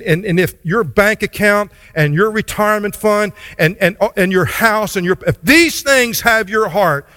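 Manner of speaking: high, loud, climbing sermon voice, pushing every phrase harder; fiery preaching. Acted. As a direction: build the list into a passionate pulpit cry. Preaching at full force on masters.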